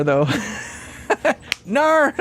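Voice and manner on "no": high, hammed-up Aussie "Nar"; imitated Australian accent. Teasing Australians on how they say it, mock Australian accent. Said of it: "I love the way you say nar".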